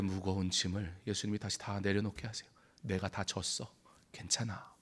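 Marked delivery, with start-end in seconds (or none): 2.8-4.7 tender comfort. Acted. Jesus gently comforts disciples.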